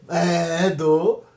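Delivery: low, dragged-out reluctant reply; sheepish admission. Acted. Pastor admits teaching to a questioner, sheepish admission. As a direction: concede it with the voice dropped.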